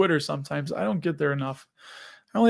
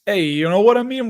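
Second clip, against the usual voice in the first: louder, higher mock Chicago accent; attempted Chicago accent; 4 semitones higher, 5 dB louder than usual. Shown as a demonstration. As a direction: put on a rough try at Chicago speech. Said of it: "try to do a Chicago accent".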